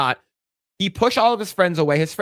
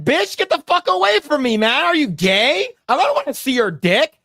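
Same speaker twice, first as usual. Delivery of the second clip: mock-outraged guy, pitched much higher; disgusted guy; 10 semitones higher, 3 dB louder than usual. Mock guy yelling at a friend to back off, pitched high and outraged. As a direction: recoil from him like he's lost his mind, voice jumping up high. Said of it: "You're like".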